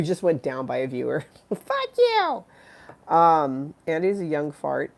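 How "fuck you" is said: high cartoon squeak with a laugh; squeaky mock insult. Teasing a viewer who left, squeaky mock insult. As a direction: fling it in a tiny silly voice.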